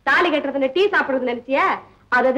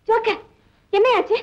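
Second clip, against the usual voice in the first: high, anxious call with a rising edge; worried calling; 8 semitones higher than usual. Acted. Worried about Joker, calling his name again and again. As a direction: call out, fearing something is wrong.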